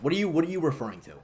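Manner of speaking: ordinary talk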